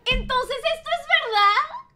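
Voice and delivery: high, loud disbelief; incredulous question. Real, not acted. Incredulous reaction to a clip.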